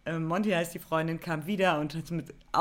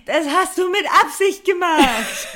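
put-on high, loud childish accusation through laughter; 10 semitones higher, 9 dB louder, slower than usual. Accusing the guy who spilled beer, childish and loud. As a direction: whine it like a little kid caught out. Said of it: "wie so ein Kind reagiert".

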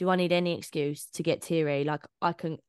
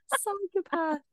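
mock self-insult; 12 semitones higher, slower than usual. Real, not acted. Mock self-label after the story.

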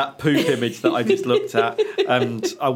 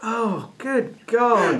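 disgusted; 7 semitones higher, much slower than usual. Real, not acted. Reacting to gross picture.

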